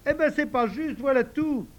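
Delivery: high whiny complaint; sulky protest. Acted. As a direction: pout that it's unfair. Joe sulks.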